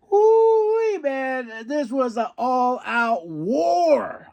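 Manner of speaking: high, slow cry of joy; excited whoop. Real, not acted. Fan whooping about an all-out war, thrilled. Hyped about match of night.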